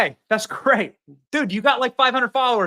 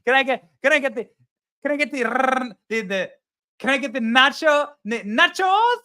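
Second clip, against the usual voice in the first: higher-pitched fake Mexican accent, rising at the end; mock Mexican accent; 4 semitones higher than usual. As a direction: imitate a mother-in-law hamming up Spanish food words. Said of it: "starts speaking in a Mexican accent".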